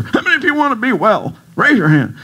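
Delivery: high, bright-pitched hype speaker asking for hands; motivational speaker. Pretend. Mocking the motivational speaker he refuses to be, hyped. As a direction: ham up a cheesy motivational speaker. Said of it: "I'm not gonna be the motivational speaker".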